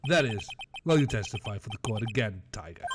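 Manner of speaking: smug low prosecutor. Acted. Prosecutor taunting witness, low and smug.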